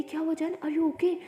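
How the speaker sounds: alarmed and too loud, in the middle of class; loud worried. Acted. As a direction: blurt out the concern loud enough for the class. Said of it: "lily इतने ज़ोर से बोलती है".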